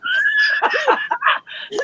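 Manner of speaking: a sudden high shrieking laugh. Real, not acted. Laughing outburst mid rant.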